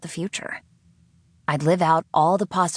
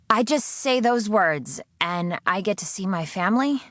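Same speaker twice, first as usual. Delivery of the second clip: incredulous check; 6 semitones higher than usual. Acted. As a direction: make sure you heard right. Sydney checks.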